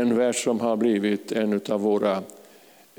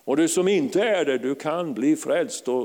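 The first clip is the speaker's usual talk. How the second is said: normal talking voice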